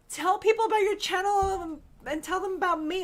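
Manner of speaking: put-on high, loud whiny kid voice; mock a pushy little girl; mimicking daughter. Mother mimics daughter begging for attention, high and whiny.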